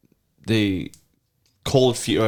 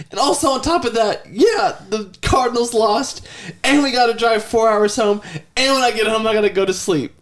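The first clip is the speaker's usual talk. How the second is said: put-on high complaining child's voice; whiny kid voice; 11 semitones higher than usual. Father imitates his son's whining complaints. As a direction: list the grievances like a sulky boy.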